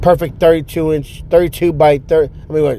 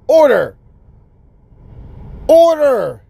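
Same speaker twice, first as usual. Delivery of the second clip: each order higher and more desperate; frantic ordering; 9 semitones higher, slower than usual. Retold or imitated. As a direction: call out order, climbing in pitch each time. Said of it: "frantically pushing the order button".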